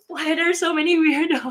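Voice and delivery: high, laughing exasperation; laughing mock complaint. Mocking the chat weirdos, high and laughing. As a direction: whine through laughter.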